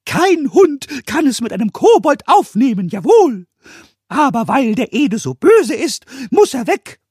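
hohe, laute Koboldstimme, trotzig vorwurfsvoll; vorwurfsvoller Kobold. A narrator's reading. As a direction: Kobold stemmt die Hände in die Hüften und trumpft auf. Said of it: "schaute Meister Eder vorwurfsvoll an". (Pumuckl wirft Meister Eder trotzig vor, hoch und laut)

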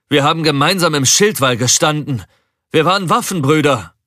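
high, loud desperate protest; impassioned, pleading outcry, pitched well up; desperate appeal. A narrator's reading. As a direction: cry out to a former comrade in despair. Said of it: "In Breckers Stimme schwang bereits Verzweiflung mit".

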